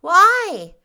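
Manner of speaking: put-on high, loud childish question; Davy, querying. A narrator's reading. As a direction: demand it like a curious small boy. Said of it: "queried Davy".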